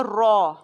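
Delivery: را said heavy, rounded; heavy Quranic را; thick ra. Shown as a demonstration. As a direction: give را its full weight. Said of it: "موٹا پڑھا جائے گا".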